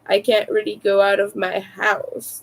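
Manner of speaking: normal talking voice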